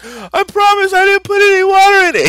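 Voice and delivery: very high, loud mock wail; imitating a kid crying his denial; hammed-up, squealing sob-plea; tearful pleading. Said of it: "but he starts crying".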